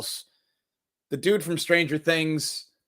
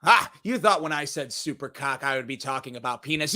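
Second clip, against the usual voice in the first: normal talking voice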